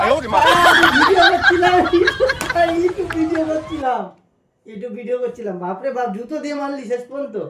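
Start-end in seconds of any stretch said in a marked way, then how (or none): 0.0-4.0 laughing. Real, not acted. Revealing the prank while laughing.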